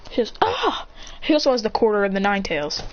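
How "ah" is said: short high "ah" cry; high exclamation. Retold or imitated. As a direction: let out a quick, pitched-up "ah". Voiced reaction in the story.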